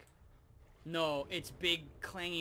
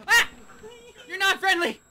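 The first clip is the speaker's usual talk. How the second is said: being chased; 20 dB louder than usual. Real, not acted.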